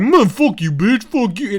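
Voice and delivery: hammed-up street tough cursing; mock angry insult. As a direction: bark the insult like a posturing thug. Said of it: "he's like".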